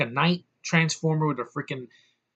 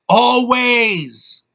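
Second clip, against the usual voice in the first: yelled much higher and louder; a gleeful over-the-top yell of Always; shouted always; 9 semitones higher, 10 dB louder than usual. Real, not acted. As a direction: blast the word Always at full volume.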